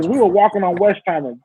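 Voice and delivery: ordinary talk, nothing marked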